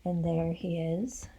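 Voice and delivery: quiet storyteller aside; hushed aside. Acted. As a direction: lean in and murmur it. Aside to listener.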